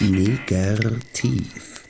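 put-on very slow, drawn-out Bulgarian-accented Negativ; imitating the Bulgarian boss's accent on "Negativ". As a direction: stretch "Negativ" the way a Bulgarian boss says it, with a rolled r. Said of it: "wie seine aus Bulgarien stammende Chefin es aussprach".

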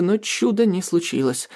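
plain reading voice

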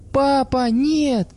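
high desperate scream. A narrator's reading. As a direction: scream it to stop him. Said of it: "закричала она".

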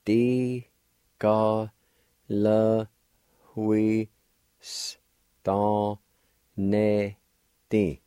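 slow syllable drill. Shown as a demonstration. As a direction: Di ga le hui s don ne di, each apart. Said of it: "like this".

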